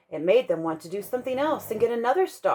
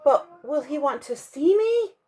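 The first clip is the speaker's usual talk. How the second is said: anxious call out; 7 semitones higher, 3 dB louder than usual. Acted. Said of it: "Punchinello cried out".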